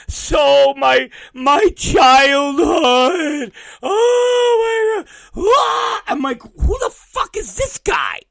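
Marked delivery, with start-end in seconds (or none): 0.1-6.1 loud, high, drawn-out fake bawling; hammed-up grief of a devastated fan; mock wailing. Mocking fans grieving a celebrity. 6.4-8.2 high-pitched, incredulous demand; mock-baffled squeal of disbelief; baffled outburst. His baffled reaction, retold.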